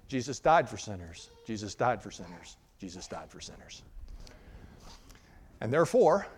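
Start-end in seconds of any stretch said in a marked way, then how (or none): 0.1-3.8 low, softening to near silence; quiet refrain dying away; hushed answer fading out. Acted. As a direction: repeat it lower and softer each time. Answer to the devil, repeated softer.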